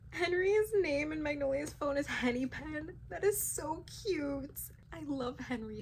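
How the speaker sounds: high, gushing delight; high-pitched swooning. Real, not acted. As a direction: squeal over something adorable. Gushing over cute nickname.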